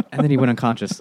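usual speaking voice

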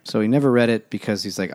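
normal talking voice

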